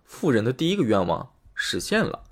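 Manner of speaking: ordinary delivery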